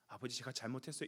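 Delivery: low, soft, quick apology; apologetic younger brother. Acted. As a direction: own up meekly to father. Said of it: "동생이 어떻게 나와야 됩니까". (낮고 작게 잘못을 비는 말투)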